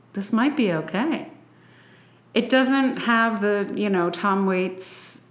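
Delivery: ordinary talk, nothing marked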